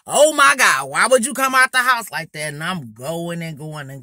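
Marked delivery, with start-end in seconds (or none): 0.1-2.5 loud, high, outraged mock horror; loud roasting. Girl roasting the smelly boy in front of everyone, loud mock outrage. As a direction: holler it as a scandalised roast. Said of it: "So I'm loud".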